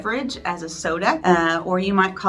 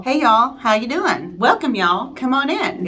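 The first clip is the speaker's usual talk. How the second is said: louder, higher Oklahoma greeting; cheerful Oklahoma hostess calling out; friendly Oklahoma welcome; 6 semitones higher, 4 dB louder than usual. Shown as a demonstration. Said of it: "We say".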